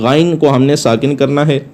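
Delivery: usual speaking voice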